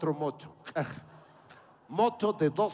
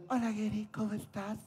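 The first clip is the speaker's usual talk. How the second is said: soft, sweet and much quieter; angelical voice; 3 semitones higher, 6 dB quieter than usual. Retold or imitated. Grown-up Carlita greeting Geri, soft and sweet. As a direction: purr it sweetly, dangerous and angelic. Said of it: "me habla con una voz angelical".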